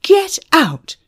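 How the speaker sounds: high, loud panicked shout; shout, voice breaking. A narrator's reading. Manager pointing Emma to the door, breaking shout. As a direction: shout the order till it nearly cracks. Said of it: "he shouted, his voice breaking a little".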